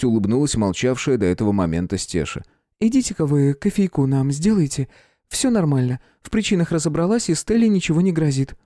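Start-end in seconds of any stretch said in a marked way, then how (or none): 2.8-8.6 put-on higher, lightly smiling girl's voice; reassuring young woman. A narrator's reading. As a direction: calmly send the men away. Princess reassuring the men. (успокаивающий девичий голос)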